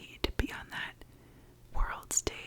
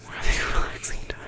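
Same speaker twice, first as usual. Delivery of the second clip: sarcastic aside; 12 dB louder, faster than usual. Real, not acted. Sarcastic after water heater rant.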